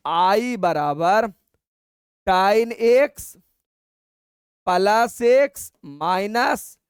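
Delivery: slow dictation. Shown as a demonstration. Teacher dictating final answer.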